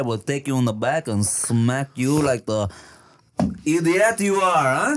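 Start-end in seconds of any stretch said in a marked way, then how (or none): none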